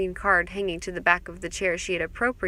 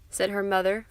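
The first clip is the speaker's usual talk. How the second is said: plain reading voice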